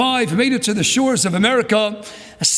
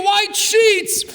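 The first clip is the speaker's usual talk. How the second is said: emphatic; 9 semitones higher than usual. Real, not acted. Rabbi exclaims the survivor's gratitude.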